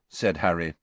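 ordinary delivery

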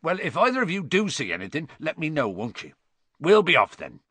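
Hagrid. A narrator's reading. Hagrid takes leave of the centaurs.

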